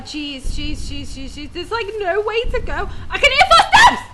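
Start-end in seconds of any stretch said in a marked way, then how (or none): 3.1-4.0 high, loud panicked laughing cry; laugh-scream as footsteps close in; real fear breaking into a laugh; shrieking nervous laugh. Real, not acted. Terrified, laughing.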